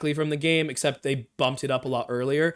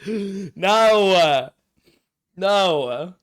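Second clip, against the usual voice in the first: loud, high drawn-out "no"; appalled, stretched-out cry of no; horrified refusal; 6 semitones higher, 8 dB louder than usual. Real, not acted. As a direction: wail it in protest, pitch raised. Still recoiling from the scary sound.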